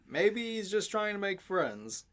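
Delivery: usual speaking voice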